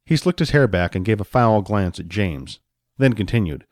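usual speaking voice

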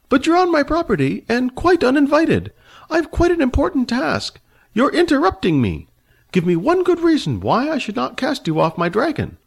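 put-on high, fussy old man, haughty and annoyed. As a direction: play the prickly old dragon rider, voice pitched high. Narrator voices the old man.

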